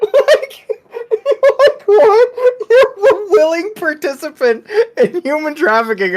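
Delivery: high, laughing disbelief; laughing incredulity. Real, not acted. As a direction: squeal it through helpless laughter.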